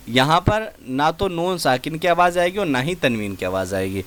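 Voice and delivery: usual speaking voice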